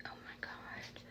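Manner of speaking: soft, breathless whisper; dread whisper. Retold or imitated. Roommate whispering after the towel yell, dread. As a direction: barely breathe it out in alarm.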